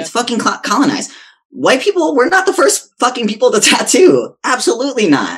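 loud, high, fired-up rant; heated rant. Real, not acted. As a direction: rant it, pitch climbing. Heated rant about colonized tattooing.

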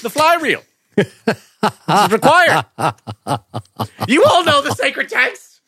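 high, slow pompous robot-priest; hammed-up high solemn decree; mock solemn Cylon. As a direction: declare the fly-fishing law grandly. Voicing actor as Cylon.

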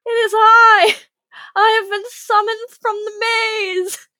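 high, loud, slow otherworldly proclamation; grand summoned spirit. Retold or imitated. Player playing the summoned beloved to the guy, booming and slow. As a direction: announce yourself like a spirit risen from the maze.

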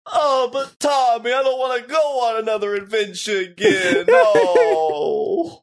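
cartoon sidekick begging to stay home; whiny cartoon kid. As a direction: whimper it like a scared cartoon sidekick. Answering as Chuckie in parody.

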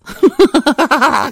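real laugh, high and loud; high burst of laughter. Real, not acted. Podcaster laughing at her own asbestos mouth joke, high and loud. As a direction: crack up at your own joke.

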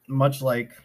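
hiccuping mid-sentence; interrupted by a hiccup. Real, not acted. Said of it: "excuse me, I have the hiccups".

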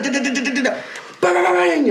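high, laughing da da and bang; gunfire noises. Retold or imitated. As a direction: rattle off the shots, laughing. Imitating gunfire.

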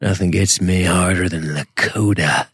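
slow seductive voice; slow and sultry, lightly voiced; sexy voice. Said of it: "That's [name]' sexy voice".

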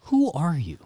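normal talking voice

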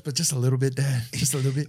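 lightly put-on pleading kid voice to Dad; a hint of a whiny kid asking Dad; Loomis voice. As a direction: beg Dad for just a little bit. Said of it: "Starting to do the Loomis voice".

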